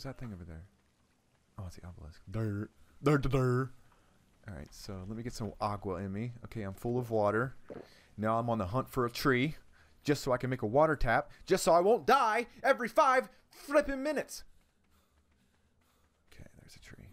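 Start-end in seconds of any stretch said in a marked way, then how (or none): 2.3-3.7 mock dumb durr. Mocking himself as dumb. 11.5-14.4 exasperated. Real, not acted. Exasperated about dying often. 16.3-17.1 hushed whisper, barely voiced; whispering on spotting the tree. Real, not acted.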